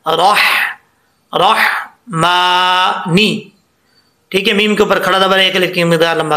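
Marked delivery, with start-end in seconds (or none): 1.4-3.5 रहमानी very slow, with a light thin हा; slow Quranic recitation. Shown as a demonstration. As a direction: recite रहमानी slowly, keeping the हा fine. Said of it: "ये हा बरीक पढ़ेंगे". (रहमानी धीरे, बारीक हा के साथ)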